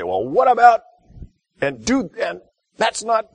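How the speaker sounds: high, loud, pushy advice-giving husband; eager husband fixing. Retold or imitated. Husband cutting his wife off with fixes, high and loud. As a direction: jump in eagerly with solutions, voice shooting up.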